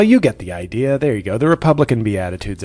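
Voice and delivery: ordinary delivery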